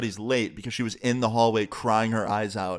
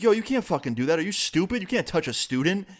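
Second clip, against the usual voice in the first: higher-pitched mock indignant protest; acted indignant outburst; 7 semitones higher than usual. Friend standing up to the teacher, acted indignation. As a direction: act out a friend's outraged objection, pitched up.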